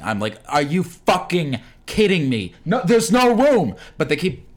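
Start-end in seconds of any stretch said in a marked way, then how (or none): 0.5-3.8 exasperated outburst, building louder and higher; loud swearing. Retold or imitated. Rider on a packed subway train, swearing loudly. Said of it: "I'm, like, loudly swearing".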